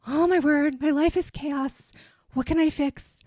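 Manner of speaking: loud, high, frantic outburst; mock panic. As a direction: blurt it like your whole life is falling apart. Acted panicked inner voice.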